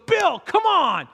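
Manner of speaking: high, slow shout; calling out. Retold or imitated. Brent in the back seat rallying Bill, a high slow shout. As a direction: call to your buddy to get moving. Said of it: "Brent in the back seat goes".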